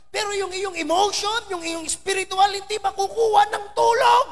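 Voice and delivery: high and loud, building conviction; rising preaching. Real, not acted. Pastor urging the congregation, high and loud. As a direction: press the point hard.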